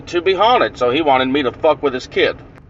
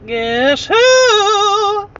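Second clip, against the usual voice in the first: very high, long-held last word; prankster's creepy falsetto. Retold or imitated. Prankster answers the boy from inside closet, drawn-out falsetto tease. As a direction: squeal it and stretch out the end. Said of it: "I said".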